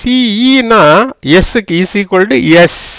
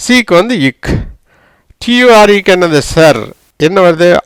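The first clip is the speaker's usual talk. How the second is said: usual speaking voice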